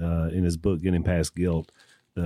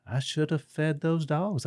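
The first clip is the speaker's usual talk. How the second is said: higher, slow mopey son voice; mock sad son; 7 semitones higher, 5 dB quieter than usual. Preacher voicing the son in a parable, mopey and slow. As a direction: play the guilty boy, drawn out. Son's regret in retold parable.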